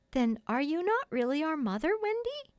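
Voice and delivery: put-on high, questioning little-boy voice; lightly voiced little boy, rising high at the end; sleepy child. A narrator's reading. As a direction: ask like a puzzled small child. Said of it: "who was surely sleepy".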